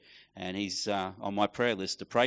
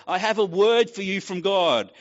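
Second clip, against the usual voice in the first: higher, louder solemn pronouncement; prophetic lady; 8 semitones higher, 7 dB louder than usual. Retold or imitated. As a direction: announce it like a prophecy. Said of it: "she said".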